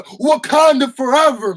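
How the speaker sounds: battle cry. Retold or imitated.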